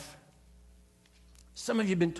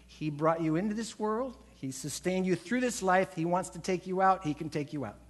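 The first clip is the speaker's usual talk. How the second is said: ordinary talk, nothing marked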